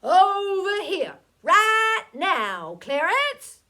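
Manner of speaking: loud, high holler across a field. A narrator's reading. Man calling his mule from the fence, hollering. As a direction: call the mule in with a big shout. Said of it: "he'd a holler".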